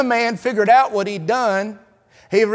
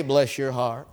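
syrupy pity; 7 semitones lower, 7 dB quieter than usual. Acted. Mock insult in Southern politeness.

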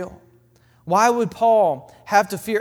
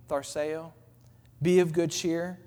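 softer, gently reassuring Tharseo; the Lord's hushed voice; 3 semitones lower, 6 dB quieter than usual. Acted. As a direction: let the words fall quietly like comfort. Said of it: "Can you hear the Lord saying".

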